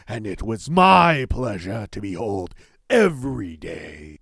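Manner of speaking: savage monster roar. Acted. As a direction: roar at the thief like a wronged beast. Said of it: "the monster roared in a savage voice".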